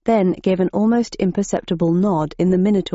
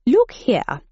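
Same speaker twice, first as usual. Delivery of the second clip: posh gentleman; 5 semitones higher than usual. A narrator's reading. Replied the character in the green.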